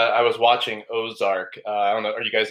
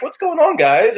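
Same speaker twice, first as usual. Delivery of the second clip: exasperated; 12 semitones higher, 4 dB louder than usual. Retold or imitated. Mock exasperated at glove litterers.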